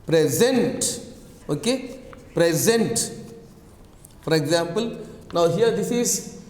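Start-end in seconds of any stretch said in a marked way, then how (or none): 0.0-1.1 "Present" with the second syllable stressed and drawn out; stress on last syllable. Shown as a demonstration. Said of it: "last syllable". 2.3-3.1 "Present" with the second syllable stressed and drawn out; stress on last syllable. Shown as a demonstration. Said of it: "stress on last syllable".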